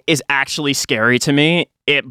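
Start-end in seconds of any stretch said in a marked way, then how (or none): none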